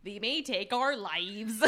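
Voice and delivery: slow Midwestern accent, each syllable spelled out; overpronounced, every syllable. Shown as a demonstration. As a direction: drag out "They may take our lives" syllable by syllable in a Midwestern accent. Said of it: "Just overpronounce every syllable".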